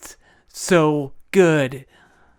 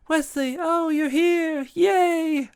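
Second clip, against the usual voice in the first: put-on much higher, squealing girly voice; giddy princess; 13 semitones higher, slower than usual. Buttercup greets her returned love in a high squeal.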